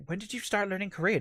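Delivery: quick, high-pitched puzzled question; mock bafflement; baffled director. Retold baffled studio director.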